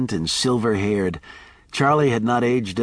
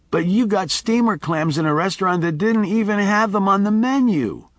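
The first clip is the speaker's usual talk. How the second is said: put-on high, whiny child's complaint; ten-year-old's whine; 10 semitones higher than usual. A narrator's reading. Grown Miles whining at Charlie like a boy. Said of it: "with a ten-year-old's whine".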